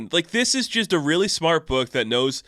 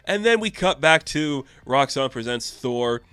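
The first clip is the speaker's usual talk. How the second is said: normal talking voice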